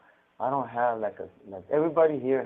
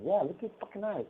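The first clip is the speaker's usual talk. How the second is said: mock boss, calling it out; 9 semitones higher than usual. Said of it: "He goes".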